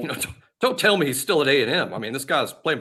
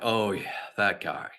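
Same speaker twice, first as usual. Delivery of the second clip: sigh; 4 semitones lower, 6 dB quieter, slower than usual. Retold or imitated. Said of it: "I sighed when I saw his name".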